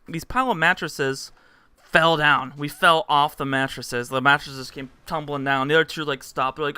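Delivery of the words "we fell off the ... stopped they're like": ordinary talk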